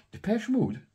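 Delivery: high, loud incredulous question; shocked squeal of disbelief; disbelieving. Real, not acted. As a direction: react like you can't believe it. Surprised disbelief at record.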